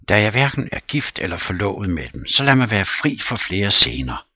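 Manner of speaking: loud, fast grumbling; Smirnov exasperated. Acted. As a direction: push the words out, sick of scenes. Smirnov fed up.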